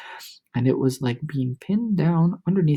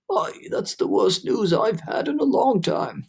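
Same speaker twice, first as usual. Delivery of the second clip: gloomy earthworm; 5 semitones higher than usual. A narrator's reading.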